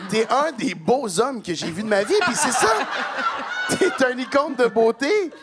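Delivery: hyped-up gushing compliments. Acted. Heaping praise on an embarrassed friend, hyped-up gushing. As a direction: shout the compliments like a hammed-up superfan.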